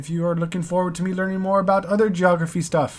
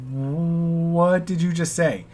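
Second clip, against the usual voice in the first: baffled American; much slower than usual. Retold or imitated.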